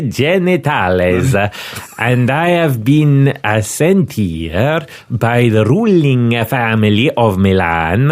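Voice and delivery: put-on a pompous Milanese inspector in an Italian accent. Inspector introduces himself to Scotland Yard, Italian accent. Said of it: "to do the accent".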